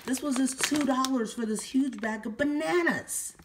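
high-pitched, gushing excitement; too excited. Real, not acted. Shopper amazed at cheap bananas, overexcited. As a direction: get carried away, pitch way up. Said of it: "I'm too excited".

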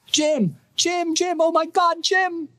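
high, frantic calling of his name in terror; terror-stricken cry. Acted. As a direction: scream for him from far off, panicking. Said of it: "a terror-stricken voice reached him".

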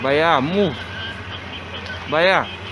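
muffled behind face covering; through a cloth mask. Real, not acted. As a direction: mouth covered by fabric. Seen 5: Cloth mask covers the speaker's mouth and nose throughout the labelled words.

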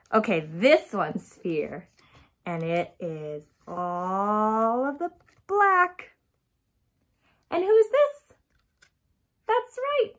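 usual speaking voice

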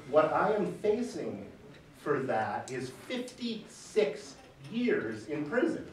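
normal talking voice

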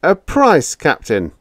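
high sly bargaining; name the price slyly. A narrator's reading. Biggs bargains coolly.

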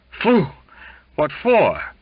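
high, loud startled yelp; shocked by cold water; sputtering startled cry. Acted. Doused with water in dream.

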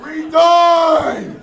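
hammed-up caveman yell of triumph; savage triumphant yell. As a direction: scream it like a caveman over his kill. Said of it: "and go like".

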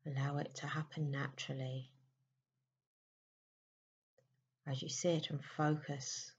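soft, unhurried meditation voice with long pauses; guided meditation. Acted. Leading listeners into a May meditation, soft and soothing.

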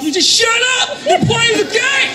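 high shouted rant at the TV; mock furious yelling. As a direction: yell at the screen like a furious fan. Angry guy yelling at TV.

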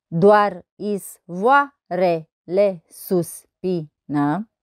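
very slow, each syllable separated. Shown as a demonstration. Teacher counting verse syllables on fingers, syllable by syllable. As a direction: count out the syllables one at a time. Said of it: "Să observăm numărând".

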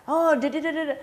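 hammed-up excited stammer, high-pitched; excited and hurried. Shown as a demonstration. Over-excited salesman rushing into the close, excited. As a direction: trip over the words in mock excitement. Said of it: "Atau excited ya, intonasi juga kecepatan".

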